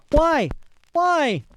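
very high anguished cry; desperate questioning. A narrator's reading. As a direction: demand a reason in high-pitched despair. Condemned flyer desperately questions emperor.

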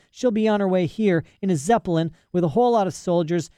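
put-on high, eager young girl; high-voiced girl talking to her father; girl's voice Lyra. A narrator's reading. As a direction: rattle it off like a bold child.

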